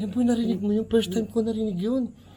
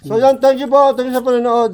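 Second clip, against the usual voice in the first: loud, higher thank-you to viewers; bright outro thanks; 5 semitones higher, 9 dB louder than usual. Real, not acted. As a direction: beam at the audience. (masiglang pasasalamat)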